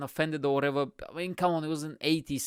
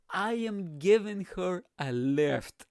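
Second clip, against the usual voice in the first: slow, higher, spaced-out mock explanation; deliberate punchline excuse; 4 semitones higher, slower than usual. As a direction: stretch each word for comic effect. Acts star's excuse as punchline.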